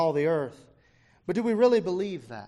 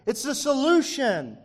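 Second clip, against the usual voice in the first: higher, slow sales slogan; infomercial slogan; 7 semitones higher, slower than usual. Retold or imitated. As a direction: imitate an advert selling a cure-all. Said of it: "All these infomercials".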